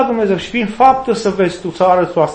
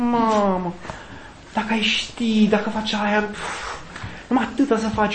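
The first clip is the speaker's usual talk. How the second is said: tempting devil; 8 dB quieter than usual. Pretend.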